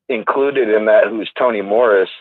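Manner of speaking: ordinary delivery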